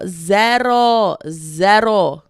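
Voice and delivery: loud, drawn-out fake Spanish accent; mock Spanish accent. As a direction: belt it out in a put-on Spanish accent. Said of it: "but with the accent".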